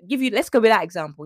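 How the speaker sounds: ordinary talk, nothing marked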